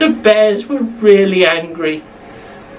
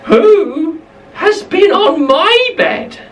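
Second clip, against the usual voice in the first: higher, slow mother bear; Mummy Bear voice; 8 semitones higher, slower than usual. Acted. Narrator to children, Mummy Bear finds her bed rolled on, higher and slow. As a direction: lift it high and draw it out slowly. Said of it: "Mummy Bear said exactly the same thing".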